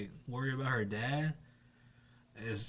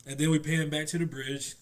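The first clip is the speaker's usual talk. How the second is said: ordinary delivery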